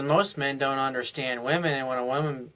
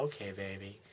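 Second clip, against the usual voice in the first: soft, lower, breathy yes; just breathe a willing okay; soft agreement; 6 semitones lower, 17 dB quieter than usual. Retold or imitated. Wife softly agreeing to her husband's suggestion, soft and low.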